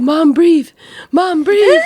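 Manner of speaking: high, loud mock-panicked coaching; worried child. Daughter coaches mom through pains, mock panic. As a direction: imitate an anxious kid urging her mom on. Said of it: "she has, like, that worried look".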